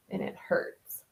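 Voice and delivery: breathy whisper, voice dropped away. Real, not acted. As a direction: whisper it like a wince of pain. Admits pain of her swollen lip.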